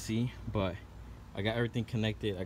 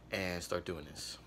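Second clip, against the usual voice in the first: ordinary talk, nothing marked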